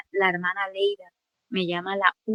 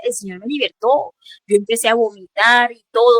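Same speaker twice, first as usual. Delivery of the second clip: ordinary delivery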